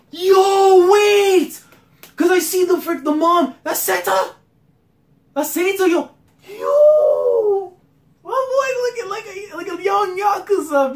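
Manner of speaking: very high, loud shouts of recognition; high loud hype; hyped fan shouting. Real, not acted. Spots a favorite character.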